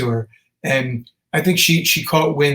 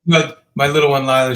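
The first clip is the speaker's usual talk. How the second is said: ordinary talk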